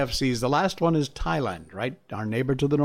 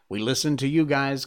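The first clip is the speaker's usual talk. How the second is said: ordinary delivery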